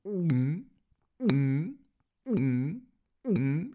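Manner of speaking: high rising swallowing hum; mock gulp. Wolf swallowing the kids whole, cartoon gulps. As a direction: gulp them down one by one.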